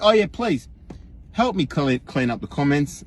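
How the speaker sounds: ordinary talk, nothing marked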